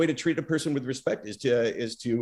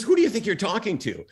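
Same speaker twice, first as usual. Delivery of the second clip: quick, high mock indignation; indignant retort; 9 semitones higher, 4 dB louder, faster than usual. Guest firing back at the host's question, retold indignation. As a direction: imitate an affronted fighter talking back fast. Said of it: "he was pissed".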